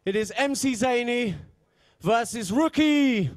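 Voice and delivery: slow, drawn-out matchup announce; ring announcer call. Real, not acted. Host introducing the first battle to the crowd, drawn-out announce. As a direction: build it like a fight card.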